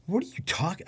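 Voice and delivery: high-pitched baffled question. Retold or imitated. To a hallucinating friend, baffled. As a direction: question him in disbelief, pitch jumping up.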